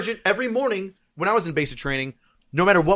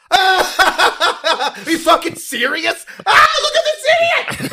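high laughing yell, slowed; laughing shout; 7 dB louder, much slower than usual. Retold or imitated. Drill sergeant laughing loudly at idiot recruit. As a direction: howl with laughter at him. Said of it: "No, I just go".

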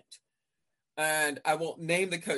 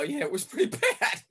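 higher, louder, breaking into a laugh; laughing admission; 6 semitones higher, 6 dB louder than usual. Retold or imitated. Referee admitting a bad call to a coach, laughing. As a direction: own up with a laugh.

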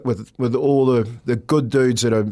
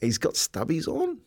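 disbelieving; 14 semitones higher than usual. Retold or imitated. Retelling disbelief at seeing Hogan.

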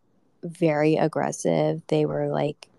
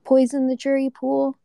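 higher-pitched rhetorical question; incredulous retort; 8 semitones higher than usual. Real, not acted. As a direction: throw the objection back, pitched high. Throws the objection back at them.

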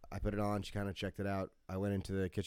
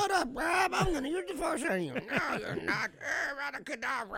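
high-pitched play-acted bickering; mock fantasy squabble. Two podcasters pretending to fight in the corner, high silly voices.

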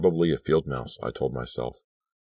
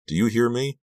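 high, rising challenge; insistent demand; 9 semitones higher, 4 dB louder than usual. A narrator's reading. Girl presses silent Lucy to respond, high insistent demand. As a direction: demand an answer, pitch climbing. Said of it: "I insisted".

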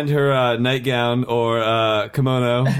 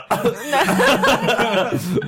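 burst of laughter; 12 semitones higher than usual. Real, not acted. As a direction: break out laughing hard. Stormtrooper in kimono joke.

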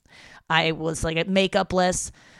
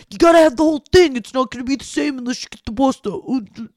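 higher, louder, fast exaggerated dad; mock dad voice; 7 semitones higher, 8 dB louder than usual. Imagined dad insisting on the whole pasta dish, hammed-up voice. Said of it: "He doesn't really talk like that".

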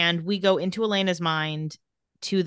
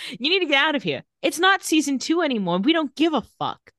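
much higher, exaggerated Elena impression; high sarcastic mock read; 9 semitones higher than usual. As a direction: parody the line like a bored writer. Comic rewrite of Elena's line.

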